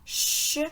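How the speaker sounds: "ch" as a voiceless, sustained shush; isolated CH sound. Shown as a demonstration. Teacher revealing the repeated sound, "ch" as a long shush. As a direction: sound out "ch" alone, not as letter names. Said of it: "les lettres qui font le son ch".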